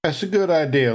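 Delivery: normal talking voice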